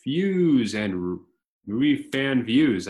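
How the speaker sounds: big, drawn-out, high-energy show opening; loud, lifted welcome, stretched out slowly; podcast intro announcer. Acted. Host welcoming listeners to the film podcast, announcer style.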